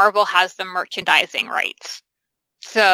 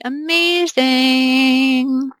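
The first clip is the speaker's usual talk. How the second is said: drawn-out, higher "amazing"; gushing enthusiasm; 4 semitones higher, 3 dB louder, much slower than usual. Real, not acted. Hoping for a Spider-Woman deal, long gushing exclamation. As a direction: stretch it out slowly with delight. Gushing over possible film.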